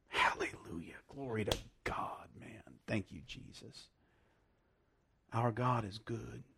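whispered, breathy praise to God; reverent whisper. Real, not acted. Preacher whispers thanks to God after the reading, awed whisper. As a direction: hush it down to a whisper of awe.

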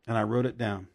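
ordinary delivery

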